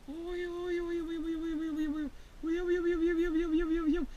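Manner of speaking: high, continuous trilling nonsense; imitated bird warble. Bear speaks bird language.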